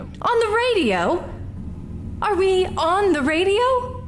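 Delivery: high, slow, startled disbelief; aghast to learn she's broadcast; shocked surprise. Acted. Learns she is on air.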